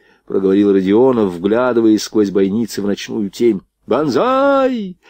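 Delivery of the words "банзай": very high, long-held war cry; battle cry. A narrator's reading. Japanese attackers very close by, high battle cry. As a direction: stretch the last vowel into a high cry. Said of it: "раздались японские крики".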